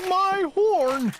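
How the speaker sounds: a sudden choked dismay; gulped dismay. A narrator's reading. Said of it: "he gulped".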